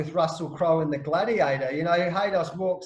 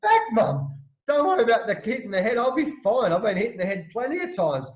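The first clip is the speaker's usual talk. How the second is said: higher and louder, laughing in disbelief; brushing off mum's worry, buzzing about the hundred; excited disbelief; 4 semitones higher, 5 dB louder than usual. Retold or imitated. Son on the phone to his mum, excited and laughing. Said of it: "And I'm going".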